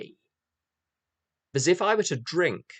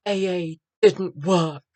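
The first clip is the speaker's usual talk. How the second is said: mock tearful; 6 semitones higher, 7 dB louder, slower than usual. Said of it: "with tears of crocodile sincerity".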